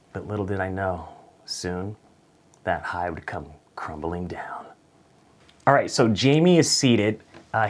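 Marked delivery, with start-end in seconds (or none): 0.1-4.6 whispered, ominous foreshadowing; soft doom-laden whisper. Acted. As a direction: hush it like a movie trailer.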